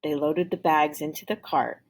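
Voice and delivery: ordinary delivery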